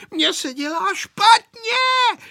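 very high, drawn-out sick wail; panicked cry. A narrator's reading. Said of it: "vykřikl znovu Pepíček". (zoufalý plačtivý výkřik)

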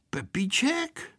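slow half-whispered question; hushed question. A narrator's reading. Boy startled by hearing his name. (tichá, šeptaná otázka)

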